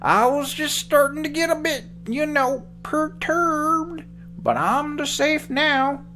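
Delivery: put-on high old man voice.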